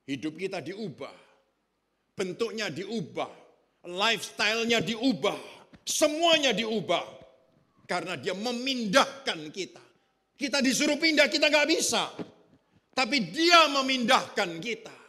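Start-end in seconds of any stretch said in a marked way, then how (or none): 2.1-12.1 building preaching crescendo. Acted. Pastor to the congregation about changed lives, building to a preaching shout. 12.9-15.0 loud, high, slow climax on "dia"; preaching shout. Acted. Pastor to the congregation, crying out "dia memindahkan kita" at full force. Climax, then the same line softly.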